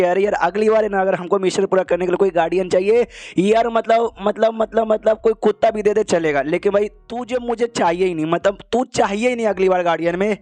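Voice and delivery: plain reading voice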